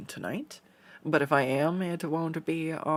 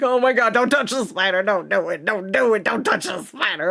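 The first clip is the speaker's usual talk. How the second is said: high, loud, frantic pleading; panicked inner scream; 6 semitones higher, 6 dB louder than usual. Retold or imitated. Alarmed at herself over a spider shed, frantic high yell. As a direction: yelp at yourself not to touch the spider. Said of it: "I'm screaming inside".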